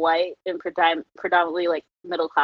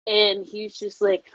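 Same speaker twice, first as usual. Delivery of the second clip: ordinary talk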